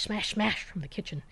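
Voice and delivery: usual speaking voice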